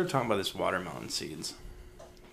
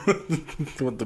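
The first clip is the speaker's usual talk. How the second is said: laughing; 5 semitones higher than usual. Real, not acted. Laughing at the joke.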